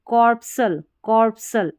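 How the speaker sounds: कॉर्पसल with a silent C; correct pronunciation. Shown as a demonstration. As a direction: say कॉर्पसल, S running into L. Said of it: "ऐसे करके पढ़ना है".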